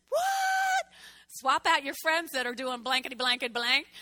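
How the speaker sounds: incredulous. Retold or imitated. Her incredulous reply retold.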